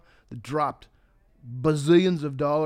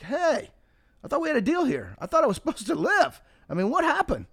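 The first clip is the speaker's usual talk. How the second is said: high, whiny protest; indignant complaint; 12 semitones higher, 4 dB louder than usual. Retold or imitated. The dead man protests to God about their deal, high and indignant. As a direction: complain to God like a man who was cheated.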